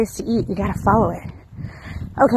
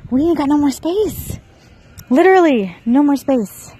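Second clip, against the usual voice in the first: louder, higher mock complaint; exasperated jokey whine; comic exasperation; 7 semitones higher, 5 dB louder than usual. Real, not acted. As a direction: play up the cramped house for laughs. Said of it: "jokey complaint about space".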